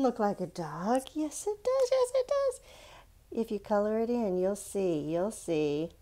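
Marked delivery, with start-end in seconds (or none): 1.1-2.6 much higher, put-on childish insistence; squeaky insistent reply. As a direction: squeak back like a stubborn kid. Answering doubt in high voice.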